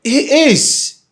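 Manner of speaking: sudden high, loud shout; jealous outburst. Acted. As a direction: explode in jealous contradiction. Jealous partner contradicts her.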